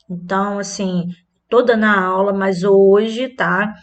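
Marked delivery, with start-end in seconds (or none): none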